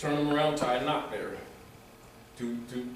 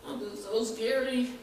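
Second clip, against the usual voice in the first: higher, slow girlish mock voice; mock Dot reply; 11 semitones higher, 4 dB quieter, slower than usual. Retold Oz, Dot refuses the witch, high and timid. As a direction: answer like a timid little girl, pitched up. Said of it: "Dot said".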